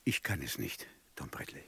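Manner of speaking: low, soft, sinking to a whisper; can hardly say it; whispered refusal. Acted. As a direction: judge whispers that he cannot do it.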